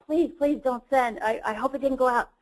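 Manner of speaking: beg, as if hoping to undo it; anxious pleading. Retold or imitated. Lawyer regretting the email she just sent, mock-anxious pleading. Retold plea after sending the email.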